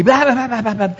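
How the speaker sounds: high-pitched mock quarrel babble. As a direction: imitate a heated spat in rapid nonsense syllables. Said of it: "ya estábamos alzando las voces".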